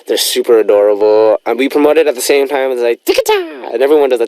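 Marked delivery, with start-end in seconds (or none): 3.0-3.7 squeaky little imitation of an idol chant; high-pitched catchphrase. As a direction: toss it out high, drawing out the last syllable.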